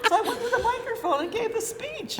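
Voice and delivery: high, loud laughing retelling; amused at her own boldness, laughing. Real, not acted.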